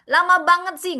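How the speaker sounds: loud, high mock grumble; complaining. Imitating people in a long wait, complaining. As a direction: whine it impatiently like someone sick of waiting. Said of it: "orang akan mengeluh".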